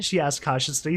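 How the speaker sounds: ordinary delivery, nothing marked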